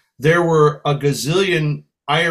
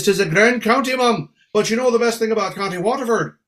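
higher, teasing Irish lilt; Irish brogue; 6 semitones higher than usual. Acted. Singer humouring the lady before a joke, Irish brogue. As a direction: set up the jab in an Irish brogue. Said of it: "I says".

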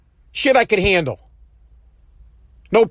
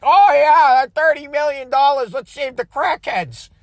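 higher, exaggerated mock agreement; mocking liberals; 4 semitones higher than usual. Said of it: "some liberal mutants out there that are like".

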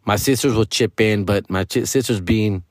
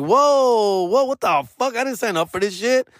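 mock shock; 10 semitones higher than usual. His shocked reply to chores.

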